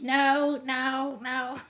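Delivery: put-on loud, rising whiny toddler no; imitating a toddler refusing bedtime; stubborn toddler refusal. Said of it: "And she was".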